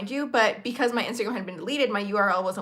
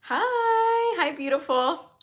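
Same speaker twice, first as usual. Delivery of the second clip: high, sing-song greeting to a pet; sweet high-pitched hi; cooing hello; 7 semitones higher, much slower than usual. Real, not acted. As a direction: coo hello like to a little darling. Greets someone off camera.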